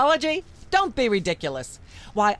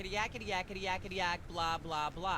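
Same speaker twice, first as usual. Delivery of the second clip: background chatter; 3 semitones lower, 15 dB quieter than usual. Acted. Myra drones on beneath talk.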